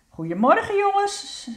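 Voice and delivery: high, sing-out morning greeting; tante Perenboom. A narrator's reading. Aunt calls the youngsters in the morning, high calling voice. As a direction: call out cheerily in a high voice. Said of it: "klinkt de stem van tante Perenboom".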